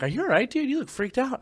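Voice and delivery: high-pitched imitation of a worried buddy; concerned Marine. Marine asks if he is okay.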